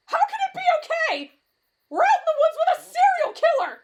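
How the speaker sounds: loud, very high shrieking; hysterical panic. Acted. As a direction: wail in terrified protest. Panicking about killer in woods.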